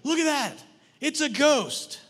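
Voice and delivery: high, loud cry of alarm; acted panicked shout; terrified yelling. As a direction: yell it out in fright, drawing out the last words. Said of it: "All those around you are yelling".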